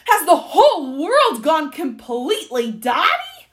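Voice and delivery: high, loud incredulous outburst; bellowing. A narrator's reading. Duke about to have a fit, bellowing. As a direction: bellow in disbelief. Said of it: "he bellowed".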